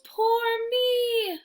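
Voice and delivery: high, hammed-up wail; mock wail. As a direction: wail it like a lost little bear. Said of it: "he wails".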